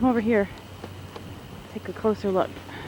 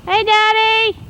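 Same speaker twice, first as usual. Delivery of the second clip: calling up; 10 semitones higher, 5 dB louder, slower than usual. Real, not acted. Calling up to dad on roof.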